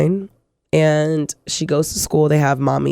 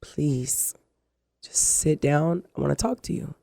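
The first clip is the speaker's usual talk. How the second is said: much softer, low and slow plea; soft pleading child; 3 semitones lower, 8 dB quieter than usual. Retold or imitated. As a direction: plead quietly like a worried little girl. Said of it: "She was like".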